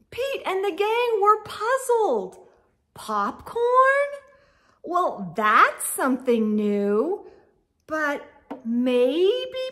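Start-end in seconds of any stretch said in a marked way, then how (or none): 2.9-4.1 high surprised question. Acted. Gang puzzled by new topping.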